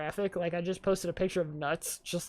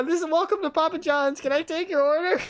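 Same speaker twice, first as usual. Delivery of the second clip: higher, laughing imitation of a pizza shop worker; pizza place greeter; 10 semitones higher, 6 dB louder than usual. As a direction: mimic the cheery phone greeting through laughter. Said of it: "And then they went".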